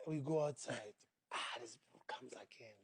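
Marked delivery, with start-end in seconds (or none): 1.3-2.8 whispered aside. Retold or imitated. Annoyed aside as fan returns.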